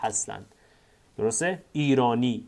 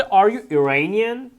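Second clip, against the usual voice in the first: slow, clear and a bit louder; slow model reading; 6 semitones higher, 4 dB louder, much slower than usual. Shown as a demonstration. Teacher reading the sentence to students, slow and clear. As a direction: spell it out slowly for the class. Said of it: "teacher models textbook sentence".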